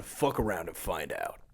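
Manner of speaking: low, slow menacing biker growl; devious biker voice. Retold or imitated. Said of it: "[name]'s devious biker voice".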